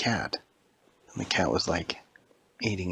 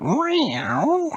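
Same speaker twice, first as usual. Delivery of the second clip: high, loud cat meow; 5 dB louder than usual. Acted. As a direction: meow like the statue woke up. Statue cat comes to life.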